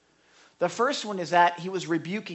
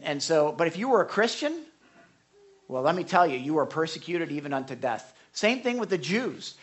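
normal talking voice

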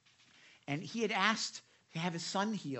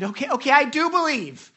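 much higher, louder eager declaration; exclaiming father; 8 semitones higher, 10 dB louder than usual. Retold or imitated. Boy's father exclaims to Jesus, urgent and high. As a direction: blurt it out with urgency. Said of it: "the boy's father exclaimed".

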